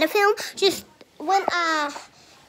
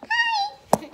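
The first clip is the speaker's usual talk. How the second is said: tiny squeaky puppet voice, much higher; squeaky hand puppet. Pretend. Girl voicing her left hand puppet, squeaky hi. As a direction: make the hand squeak hello. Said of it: "Say hi, left hand".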